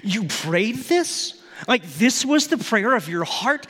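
stunned by her prayer; incredulous. Retold or imitated. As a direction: son reacting to mom's prayer, amazed. Incredulous reply to mom.

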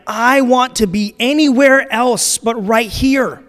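loud, high, drawn-out exasperation; slow, emphatic mock desperation; fed-up inner cry. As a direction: act out desperately wanting to escape. Acted inner cry of fed-up listener.

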